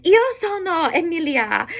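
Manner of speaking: "Io sono Emilia" pitched high, as if answering a call; emphatic. Shown as a demonstration. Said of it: "I would say it for emphasis".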